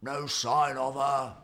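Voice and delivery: slow, weary sigh; a worried bear giving bad news. A narrator's reading. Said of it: "he sighed".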